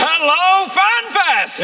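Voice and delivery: slow, high stadium greeting; crowd greeting shout. Real, not acted. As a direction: call out to the arena.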